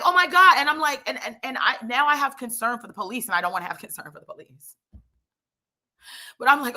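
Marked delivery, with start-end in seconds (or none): 0.0-0.6 high, loud panicked oh my God; blurting it out in alarm; panicked gasp. Retold or imitated. Racing heart at cop by traffic, panicked cry. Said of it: "my heart starts racing".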